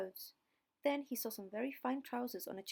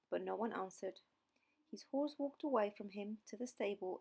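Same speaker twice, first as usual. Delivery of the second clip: plain reading voice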